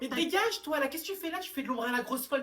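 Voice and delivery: high, loud get lost; angry yell. Acted. Girl shouting at a boy to get lost, angry. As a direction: shout him away. Student yelling at another.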